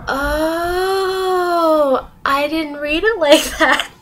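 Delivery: long drawn-out high oh, then slow admission; sheepish realisation. Retold or imitated. Student realising she misread the instructions, sheepish. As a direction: let the mistake dawn slowly.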